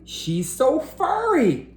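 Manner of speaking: high gushing voice. Acted. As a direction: adore the furry monkey. Clinton gushing over monkey.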